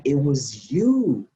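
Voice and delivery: YOU stretched out slowly; slow accusation landing long on "you"; drawn-out accusation. Retold or imitated. Realizing who robbed the car, "you" drawn out in accusation.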